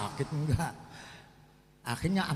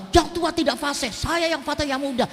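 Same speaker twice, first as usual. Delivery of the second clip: a light, higher mock of a boastful youth; cocky young man; 9 semitones higher than usual. Young men pushing elders aside, lightly mocked with a higher voice. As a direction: tease like a smug youngster bragging. Mocking young men who grab imam role.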